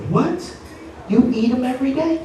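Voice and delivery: high, disbelieving double-take; shocked dad. Retold or imitated. Dad to daughter, high shocked disbelief. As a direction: squeak out the disbelief.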